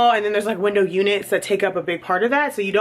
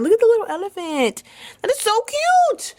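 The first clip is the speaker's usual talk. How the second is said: cooing; 13 semitones higher than usual. Real, not acted. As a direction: gush over the little elephant. Cooing over a plant detail.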